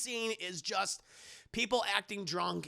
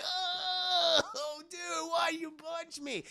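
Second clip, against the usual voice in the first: mock hurt whine; 10 semitones higher, much slower than usual. Guy Madea just punched, pained whine. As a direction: whimper it slowly like a hurt frat boy.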